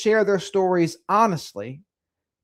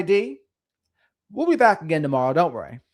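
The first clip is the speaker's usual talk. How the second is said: ordinary talk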